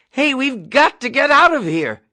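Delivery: Nately trapped in the overturned jeep, panicked cry; frightened cry. Acted. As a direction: cry out in panic as whiskey soaks him. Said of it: "he cried".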